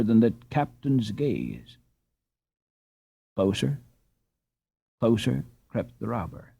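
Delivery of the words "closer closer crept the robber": ordinary delivery, nothing marked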